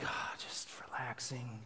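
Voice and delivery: very soft, whispery exhale; relaxed sigh. Retold or imitated. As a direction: sigh it out almost without voice. Acts out day-off relaxation.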